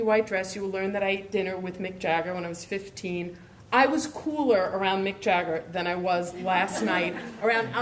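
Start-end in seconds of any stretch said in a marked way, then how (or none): none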